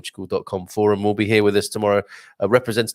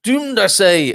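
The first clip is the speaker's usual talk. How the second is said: high, slow, hammed-up Scottish doom-monger; a gloomy Scotsman moaning in a Scottish accent; 4 dB louder, slower than usual. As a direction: wail it in a mock Scottish accent. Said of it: "with a Scottish accent".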